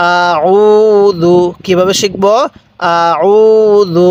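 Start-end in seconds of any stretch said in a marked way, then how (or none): 0.1-1.4 আ উ জু with উ held long and level; one-alif elongation. Shown as a demonstration. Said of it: "এক আলিফ টান দিছি". 2.9-4.1 আ উ জু with উ held long and level; one-alif elongation. Shown as a demonstration. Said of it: "এক আলিফ টান দিছি".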